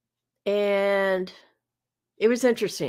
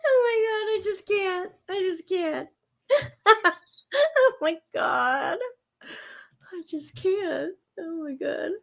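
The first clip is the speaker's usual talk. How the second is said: helpless high laughter, voice cracking; laughing to tears; 7 semitones higher than usual. Real, not acted. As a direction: laugh until you can't breathe, words squeaking out. Laughing fit over own song.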